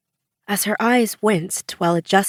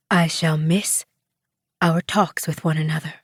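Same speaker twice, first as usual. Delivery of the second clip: lightly voiced Tom Cruise; Tom Cruise impression; 2 semitones lower than usual. A narrator's reading. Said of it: "in her best Tom Cruise impersonation".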